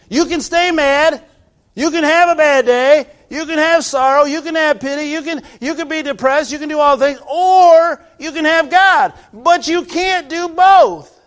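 loud, high, slowing preacher's cry; full-force preaching. Acted. Pastor assuring the sisters, loud fervent preaching.

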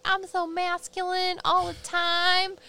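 mock the guide's manly swagger; mocking spirit guide. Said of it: "he's like".

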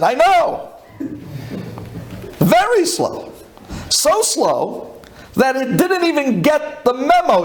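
high-pitched, drawn-out retort; emphatic comeback. Retold or imitated. Passenger to agent about the slow computers, emphatic.

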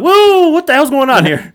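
loud, high-pitched stunned reaction; mock shock. Fan hearing actor's real accent in interview, mock shock. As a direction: blurt it out in exaggerated disbelief.

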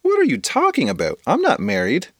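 put-on high confused kid voice; high baffled girl denying it; puzzled friend. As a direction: protest in disbelief.

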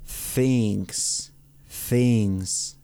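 THINGS with an unvoiced th, tongue between the teeth; th like Spanish Z. Shown as a demonstration. Teacher modelling for learners, careful th in THINGS. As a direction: draw out "Things" on a clear Castilian-z th. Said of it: "pronuncio como una Z en español".

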